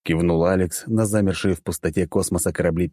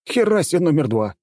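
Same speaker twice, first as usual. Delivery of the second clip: amazed exclamation, much higher; 11 semitones higher, 3 dB louder than usual. A narrator's reading. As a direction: gasp it out in astonishment. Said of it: "изумился я".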